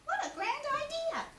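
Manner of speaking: very high squeaky delight; excited Piglet. Acted. Piglet delighted by Pooh's plan, high squeal. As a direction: squeal with joy. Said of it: "cried Piglet".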